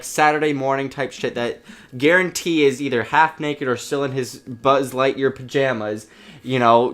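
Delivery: normal talking voice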